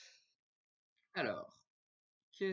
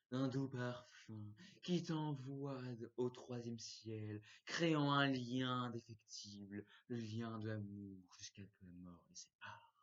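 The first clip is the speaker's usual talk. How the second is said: poetic reading; 2 semitones lower, slower than usual. Acted. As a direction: read it like a love poem.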